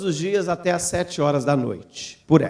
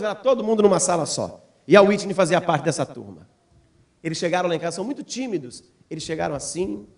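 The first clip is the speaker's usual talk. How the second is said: ordinary talk, nothing marked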